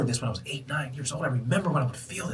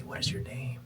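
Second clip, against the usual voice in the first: whispered question; 8 dB quieter than usual. Retold or imitated. Asks the universe.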